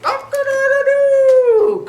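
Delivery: very high, loud, slow crow; rooster crow. Acted. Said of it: "crowed Rooster".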